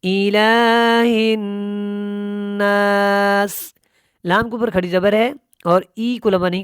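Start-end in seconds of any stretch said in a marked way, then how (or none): 0.0-3.5 drawn-out chanted tajweed recitation; slow Quran recitation. Shown as a demonstration. Tajweed teacher demonstrating recitation to students, slow chant. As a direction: intone each word slowly, melodically.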